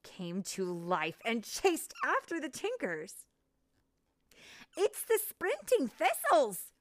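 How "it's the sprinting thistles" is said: much higher, louder panicked shout; alarmed cry. Acted. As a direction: cry out the danger. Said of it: "Clank cried".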